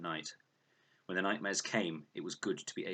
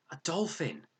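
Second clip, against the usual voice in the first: high delighted exclaim; light up at the sight; girl thrilled by a dolphin; delighted cry; 11 semitones higher, 4 dB louder than usual. A narrator's reading. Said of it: "Her whole face was transformed".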